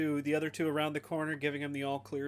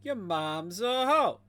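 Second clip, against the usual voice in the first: drawn-out, higher-pitched taunt; out-loud taunt; 4 semitones higher, 3 dB louder, slower than usual. Acted. Repeats the whispered taunt out loud.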